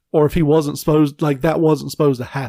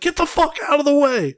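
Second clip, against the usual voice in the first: much higher mock yell; honking yell; 12 semitones higher than usual. Driver yelling at an extra blocking the car, much higher mock shout. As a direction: shout him out of the road. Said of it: "He's like".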